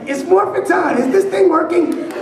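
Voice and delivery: high, loud laughing cartoon hero; laughing through a hammed-up high, loud hero voice; mock superhero catchphrase. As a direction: ham up a kids' TV hero shout. Mock Power Rangers catchphrase.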